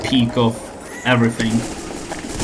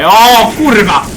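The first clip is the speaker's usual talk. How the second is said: Polish exclamation; 8 semitones higher, 6 dB louder, slower than usual. Pretend. Polish swear in game voice.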